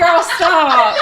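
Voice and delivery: high, laughing exasperation; laughing mock scolding. Mum retells telling her daughter to stop, laughing mock scolding. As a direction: protest through a laugh, pitched high.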